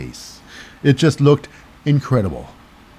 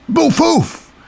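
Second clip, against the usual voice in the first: impact sounds; 12 semitones higher than usual. Retold or imitated.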